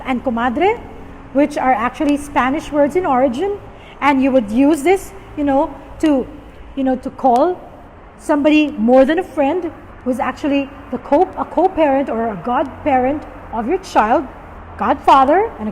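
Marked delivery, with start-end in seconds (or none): none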